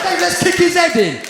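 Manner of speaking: rowdy shout. Retold or imitated. Crowd turns on the man.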